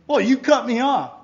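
high, loud accusation; acted indignation. Self-centered person feels cut off by another, indignant. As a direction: play someone taking loud offense.

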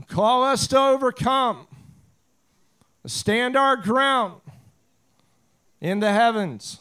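fervent prayer, building in force; public prayer. Real, not acted. Pastor praying for unction to preach, fervent and building. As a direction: call on the Lord in measured, rising phrases.

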